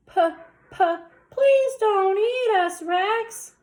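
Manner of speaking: high, loud stuttered plea; stammering fear. Acted. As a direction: beg the dinosaur in panic. Characters begging scared.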